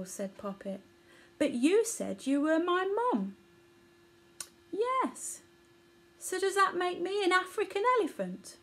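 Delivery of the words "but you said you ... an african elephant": lightly raised, puzzled little calf voice; a hint of a small confused child; baby elephant. Baby elephant Poppet speaks.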